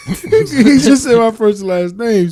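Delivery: loud high laughing; laughing shout. Real, not acted.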